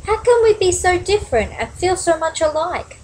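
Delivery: high, pondering little-bird voice; musing. A narrator's reading. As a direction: wonder it aloud as a storybook bird. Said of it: "mused Flitter".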